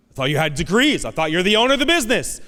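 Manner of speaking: incredulous taunt; mock disbelief. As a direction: needle them with disbelief. Mocking reply to weakness.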